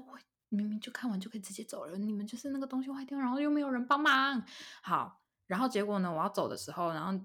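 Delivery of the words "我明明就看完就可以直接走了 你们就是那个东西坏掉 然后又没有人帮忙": fast inner grumble climbing higher; high-pitched retold griping; dumbfounded complaint. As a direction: replay a quick, exasperated complaint, rising at the end. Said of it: "我就一整个很傻眼".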